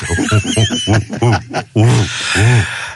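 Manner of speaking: mock stage rendition of pulsing hoots; low hoots, spaced out; imitated plane noise. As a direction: do the weird aeroplane noise for a crowd. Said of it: "coming on stage and doing me noise".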